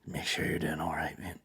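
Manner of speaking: whispered fake sympathy; mock whisper. Comedian parodies a pitying punter, whispering. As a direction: breathe it out with sarcastic pity.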